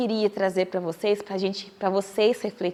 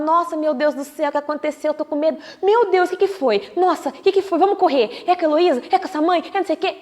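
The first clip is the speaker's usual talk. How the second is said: a worried friend arriving in a mock frantic panic; alarmed panic; 5 semitones higher, much faster than usual. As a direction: spread the panic, rushing question after question. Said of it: "se eu chego preocupada".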